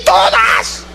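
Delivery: grito agudo y asustado, más lento. Retold or imitated. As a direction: responde con susto, la voz se dispara arriba. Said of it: "Y él se asustó y dijo". (el joven, asustado ante la pregunta, grita agudo)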